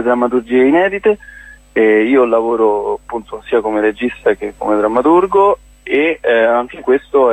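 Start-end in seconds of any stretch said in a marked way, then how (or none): none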